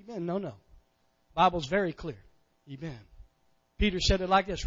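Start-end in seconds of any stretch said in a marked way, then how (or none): none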